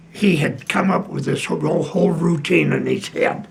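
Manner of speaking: ordinary delivery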